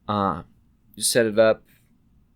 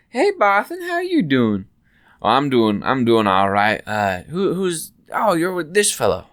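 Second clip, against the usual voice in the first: cheery shopkeeper; 4 semitones higher, 7 dB louder than usual. Acted. GM voices shopkeeper greeting.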